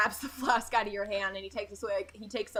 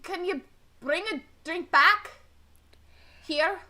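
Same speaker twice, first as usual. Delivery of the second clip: put-on drunk local; 7 semitones higher, 3 dB louder than usual. Drunk NPC asks for drink.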